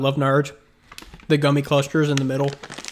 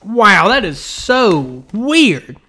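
amazed; 6 semitones higher, 5 dB louder than usual. Real, not acted. Tasting the Doctor Pepper candy.

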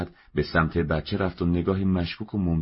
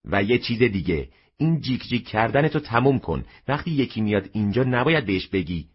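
louder, higher scolding; firmer reproach; 6 semitones higher, 4 dB louder than usual. A narrator's reading. Mother leans over and firmly scolds her son. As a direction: come down hard on the child. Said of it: "با لحن سرزنش آمیز محکمتری".

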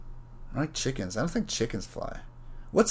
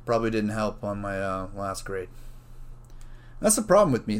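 ordinary talk

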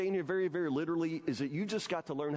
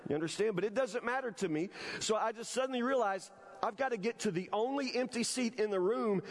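normal talking voice

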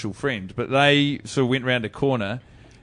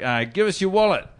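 mugger demand; 7 semitones higher than usual. Retold or imitated.

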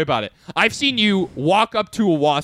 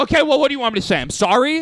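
high, mock-exasperated challenge; acting out an insincere apology; imitated grudging apology; 9 semitones higher, 3 dB louder, faster than usual. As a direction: push the question up high, defensively. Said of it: "and they go".

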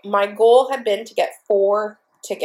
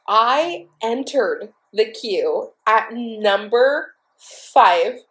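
ordinary talk

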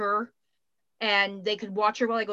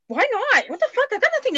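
giddy why not; 12 semitones higher than usual. Real, not acted. Talking herself into the vaccine trip.

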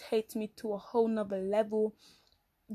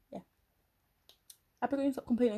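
ordinary talk, nothing marked

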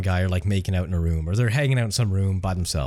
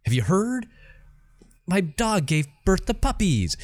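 high, drawn-out mock delight; good-news announcement; 9 semitones higher than usual. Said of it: "It didn't have to be bad news".

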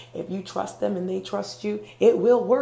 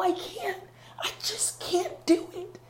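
very high, slowing helpless plea; scared; 10 semitones higher than usual. Acted. Climber refusing help from above, acted scared whimper. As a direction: give up in fear, pitched way up. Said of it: "You're scared".

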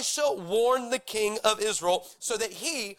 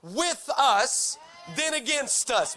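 preaching proclamation; 5 semitones higher, much slower than usual. Acted. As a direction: proclaim it like a promise.

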